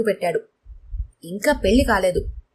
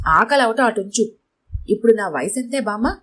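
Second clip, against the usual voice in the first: ordinary delivery, nothing marked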